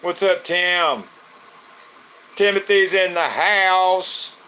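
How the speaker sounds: high, loud, slow hype-man call; stretched, loud welcome for a viewer entering the live; hype announcer greeting. Real, not acted. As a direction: announce her like she just walked into the club. Hyping arrival of viewer.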